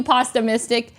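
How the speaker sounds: usual speaking voice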